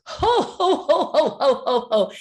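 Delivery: loud, forced ho-ho guffaw; mock big laugh. Actress imitates Tarantino laughing at her unfunny joke, exaggerated guffaw. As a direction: fake a hearty laugh, starting high and coming down. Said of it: "to do a big laugh".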